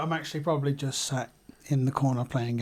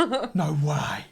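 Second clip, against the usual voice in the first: mock disbelief. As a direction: imitate someone astonished, pitched up. Retold wife's disbelief at the noise.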